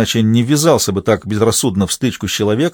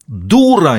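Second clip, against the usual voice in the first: higher, louder sudden outburst; sudden yell; 5 semitones higher, 4 dB louder than usual. A narrator's reading. Man suddenly yelling at the nurse, loud outburst. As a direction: shout it unexpectedly. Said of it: "неожиданно заорал Борис Алексеевич".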